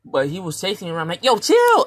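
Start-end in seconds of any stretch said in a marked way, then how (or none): 1.2-1.8 very high, loud panicked yell. Retold or imitated. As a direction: scream it while running away. Fleeing the vampire, yelling.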